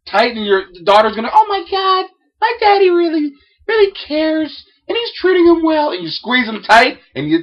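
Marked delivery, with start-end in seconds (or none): 1.3-5.9 high girly gush, hammed up; mock a starry-eyed teen girl; gushing daughter. Daughter gushing about her dad, in a high mock voice.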